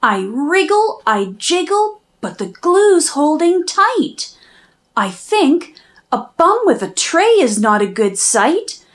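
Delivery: usual speaking voice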